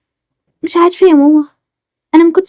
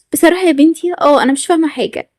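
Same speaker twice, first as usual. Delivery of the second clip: usual speaking voice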